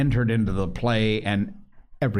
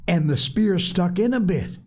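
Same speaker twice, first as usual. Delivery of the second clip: eager insistence; 11 semitones higher, 3 dB louder than usual. Acted. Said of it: "He felt the need of witnesses".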